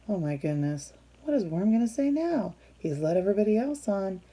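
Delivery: ordinary delivery, nothing marked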